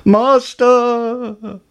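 very high, loud singing call; harp's cry. A narrator's reading. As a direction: cry out for help in long, high held notes. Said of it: "the harp cried".

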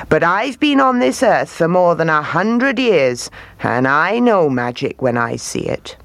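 put-on knowing old man, voice swooping up and down; Silas. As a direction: play an old man sure of what he knows.

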